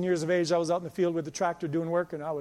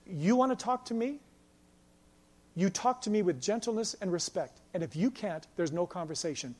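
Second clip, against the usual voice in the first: soft, controlled reply, sinking at the end; calm; 4 dB quieter than usual. Retold or imitated. Boss answering a hollering customer, calm and soft. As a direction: stay quiet and unprovoked. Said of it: "in calmness".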